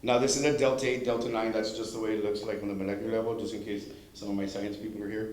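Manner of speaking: ordinary talk, nothing marked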